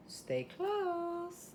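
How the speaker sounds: high, sing-song stay close; sing-song tease. Acted. Host teasing her viewers before the break, high sing-song. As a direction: lilt it up to keep viewers waiting.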